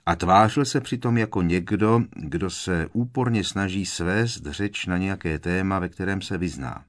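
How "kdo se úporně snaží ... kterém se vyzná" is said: ordinary delivery, nothing marked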